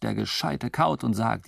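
usual speaking voice

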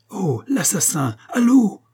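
slow jeer rising to a high, loud yell; mob howling. A narrator's reading. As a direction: howl it like a hostile crowd. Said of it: "hurlèrent quelques énergumènes". (hurlement de foule hostile)

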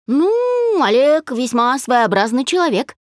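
very high, stretched opening, then lilting; wry amusement. A narrator's reading. Friend comments wryly on Dasha's ex, amused. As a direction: draw out the first word archly. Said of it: "усмехнулась Пати".

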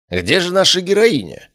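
high, theatrical mock lament; theatrical sigh. A narrator's reading. Cassian mocks the absent heroine, theatrical sigh. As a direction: sigh it out like a stage actor. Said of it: "театрально вздохнул Кассиан".

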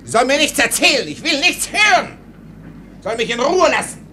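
furious rant. Acted. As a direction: fire off the orders in a rage. Officer orders servant to shut up.

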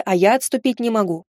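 ordinary delivery, nothing marked

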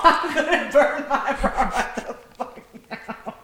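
repeating it through laughter; helpless laughter. Retold or imitated. Co-host repeating friend's outburst, laughing hard. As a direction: crack up while quoting the line.

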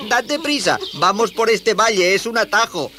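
high, excited youngster; eager, high-voiced child; urgent boy. Boy hurries friend toward the crying.

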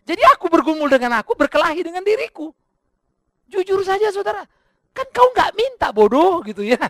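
high, loud self-argument; full-force preaching. Acted. Preacher wrestling with himself over the envelope, high loud tirade. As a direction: shout it as a heated quarrel with your own conscience.

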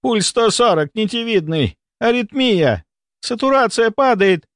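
high-pitched emergency reporting; urgent alarm. A narrator's reading. A medic reports the patient's crashing vitals, urgently. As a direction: call out each reading like an alarm.

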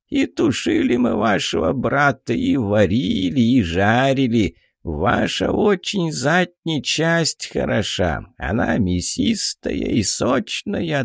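put-on an old dwarf sharing a recipe; old duergar voice. Old duergar on cooking pixies.

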